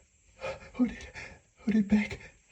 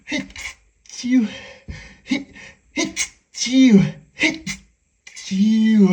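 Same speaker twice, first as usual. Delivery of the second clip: loud, broken achoos; sneeze; 4 semitones higher, 8 dB louder, much slower than usual. Acted. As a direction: sneeze you can no longer stifle. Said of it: "I can't keep stifling this".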